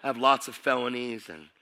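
ordinary talk, nothing marked

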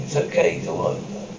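breathy hush after the struggle; whispering. Acted. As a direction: whisper to calm her. Comforting after panting.

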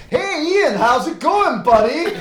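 high, hammed-up American drawl. [name] back from Houston greets Ian, hammed-up American accent. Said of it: "rednecky American".

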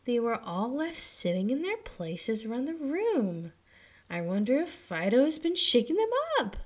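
put-on wondering aloud as a young girl; little girl voice. A narrator's reading. Mistress speaks.

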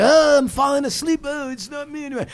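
high, hammed-up swoon; mock falling asleep. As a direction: fake going under hypnosis. Mimicking a faked hypnotic collapse.

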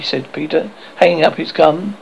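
ordinary delivery

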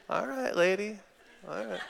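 high, soft placating reply; mock backing off. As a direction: back away verbally, humouring a scary wife. Backing off from wife's threat.

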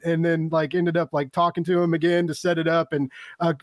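ordinary delivery

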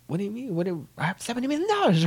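very high, loud, rushed outburst; mock incredulous squawk, words tumbling out; indignant protest. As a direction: blurt it in high-pitched disbelief. Character protests about keeping the money.

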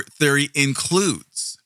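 normal talking voice

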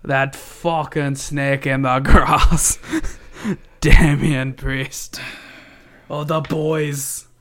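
put-on slow, drawn-out caricature Irish accent; exaggerated Irish accent, savoring each word; Finn Balor's Irish accent. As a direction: lay the Irish accent on thick, unhurried. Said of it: "I like to do that accent".

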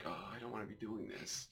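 a reluctant body, whining softly; whiny body voice. Retold or imitated. Friend retelling workout, voicing his tired body quietly. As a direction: voice your body groaning at the workout.

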